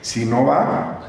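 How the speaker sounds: ordinary delivery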